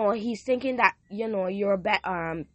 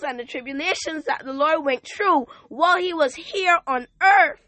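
rising, louder preacher's call to the congregation; full-force preaching; 5 semitones higher, 6 dB louder than usual. Acted. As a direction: preach it out, lifting the room. Preaching at full force, scripture quotes.

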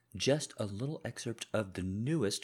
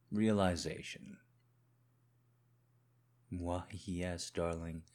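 controlled menacing husband; 3 semitones lower than usual. Acted. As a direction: speak low and controlled, as if you already know. Husband confronts wife, audio drama.

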